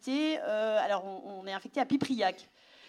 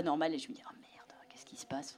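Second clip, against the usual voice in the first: chuchoté, sous le choc; shocked whisper; 6 semitones lower, 10 dB quieter than usual. Real, not acted. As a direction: souffle les mots, sonnée. Choc à l'annonce du problème. (chuchotement effaré)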